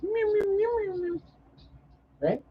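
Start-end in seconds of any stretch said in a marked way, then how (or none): 0.0-1.2 squeaky high kitten meows; high mewing. Retold or imitated. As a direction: mew like a chatty little cat. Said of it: "She goes".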